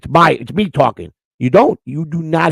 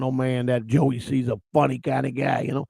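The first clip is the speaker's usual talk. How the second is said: normal talking voice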